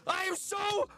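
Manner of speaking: high whiny hammed-up rant; mock frustration. Streamer ranting high-pitched at his game, mock despair. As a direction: whine in high comic despair.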